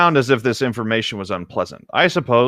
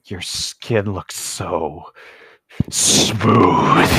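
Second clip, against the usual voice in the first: creepy; much slower than usual. Pretend. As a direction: linger on it like a creep, then blurt the last word. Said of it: "Okay, back to creepy then".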